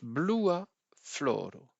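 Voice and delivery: Blua floro said slowly, each syllable clear; teacher modelling Blua floro slowly; slow demo. Shown as a demonstration. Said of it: "la terminaison A pour marquer que c'est un adjectif".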